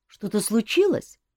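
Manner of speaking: anxious rising question; worried cousin pressing; nervous question. A narrator's reading. Nervous cousin asks king.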